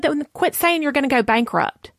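high-pitched retold telling-off; scolding. Friend correcting her bankruptcy talk, scolding.

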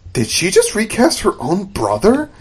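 high-pitched incredulous question; mock disbelief. Retelling a shocked character's question.

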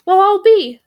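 very high, loud bird voice; a high, loud, surprised raven. Acted. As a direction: squawk it out in surprise. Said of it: "said the first raven".

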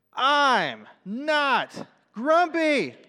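high, loud, very drawn-out storybook shout; angry shout. Acted. Grumpy Jim protests to all the other animals, shouted. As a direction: bellow each word slowly, with full indignation. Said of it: "shouted Jim".

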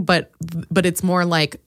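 ordinary talk

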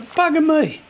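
bugger me exclamation. Retold or imitated. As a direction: blurt it out in mock dismay. Retold moment of realisation.

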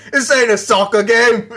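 put-on high, loud London accent through a laugh; hammed-up British fan; mock British accent. Mocking British fans.

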